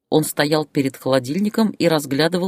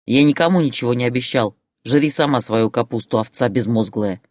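ordinary delivery, nothing marked

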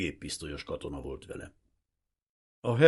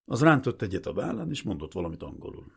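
ordinary delivery

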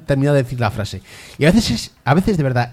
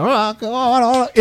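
high mock-girly hellos; girly greeting voices; 11 semitones higher than usual. Gamer imitating NPC girls chirping hello.

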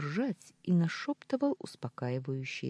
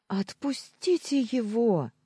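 high, loud, slow cry through tears; shouting through sobs; 9 semitones higher, 5 dB louder than usual. A narrator's reading. Julia cries out to the guards to free Kirill, high tearful shout. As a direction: beg them through sobs. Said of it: "закричала она сквозь рыдания".

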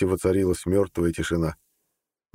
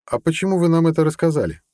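higher-pitched, probing question; suspicious; 10 semitones higher, 4 dB louder than usual. A narrator's reading. Judge questions the colonel's motives, suspicious. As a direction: question him with open distrust. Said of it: "подозрительно спросил судья".

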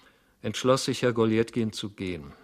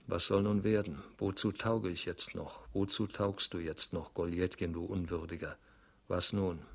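lower, softer self-reproach; mild despair; 3 semitones lower, 7 dB quieter than usual. Acted. Goljetkin reproaching himself on the street, understated despair. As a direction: sink into a quiet, dejected talk to yourself.

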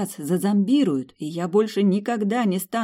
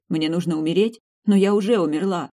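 usual speaking voice

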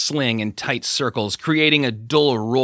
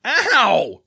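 sharp high yelp of pain; pained cry; 12 semitones higher, 4 dB louder than usual. A narrator's reading. Jason grabbed by the big guy, sharp cry of pain. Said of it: "Jason cried".

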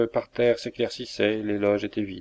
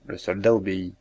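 ordinary delivery, nothing marked

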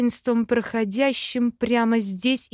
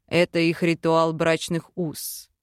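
low male voice; 6 semitones lower than usual. Acted. Vel answers at wedding.

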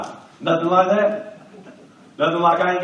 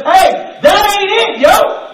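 high shouted rebuke, rising to a yell on yo; God calling you out; 11 semitones higher, 6 dB louder, much slower than usual. Acted. God speaking directly to you, a high shouted correction. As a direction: call it out like God stopping you cold. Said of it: "God has to speak directly to you and says".

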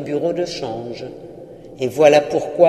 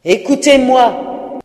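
loud, higher, slow command; ghost crying out; 7 semitones higher, 7 dB louder, slower than usual. Acted. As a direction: demand to be heard, loud and slow. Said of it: "s'écria le fantôme".

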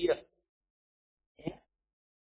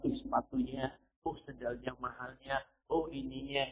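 softer, hushed admiring oohs over shoes; admiring onlookers; 5 semitones lower, 9 dB quieter than usual. Retold or imitated. Preacher imitating onlookers, softly admiring. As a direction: mimic people gawking at her expensive sandals. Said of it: "Sehingga orang lihat".

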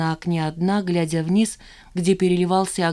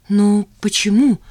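slow, louder astonished question; higher-pitched puzzled surprise; surprised; 4 semitones higher, 7 dB louder, slower than usual. A narrator's reading. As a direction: draw out the question, taken aback. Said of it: "удивилась она".

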